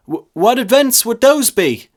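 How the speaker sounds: put-on high, far young voice, stammering; nervous lad. A narrator's reading. Lad nervously repeating his question to the priest. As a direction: stammer the question again, timid.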